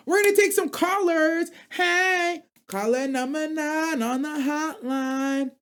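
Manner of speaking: high, slow radio host call-in voice. Acted. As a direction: announce the caller like a DJ.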